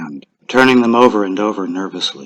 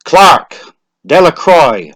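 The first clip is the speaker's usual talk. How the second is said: loud roll call; name called out loud; 6 semitones higher, 8 dB louder, slower than usual. A narrator's reading. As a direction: call the name across the crowd. Calling names at lottery.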